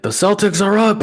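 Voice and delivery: high-pitched mock yell, held a little short of full volume; retold yell. Husband calling from the bathroom door to his wife, retold yell. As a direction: call it out in a high, excited imitation of yelling. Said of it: "I yelled to my wife".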